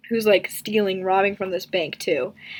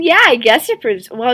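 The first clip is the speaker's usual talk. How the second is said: ordinary delivery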